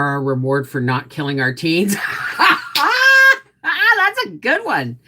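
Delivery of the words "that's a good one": high, loud burst of laughter; laughing hard at a viewer's joke; big laugh. Real, not acted. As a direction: crack up at the joke.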